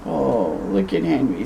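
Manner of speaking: ordinary delivery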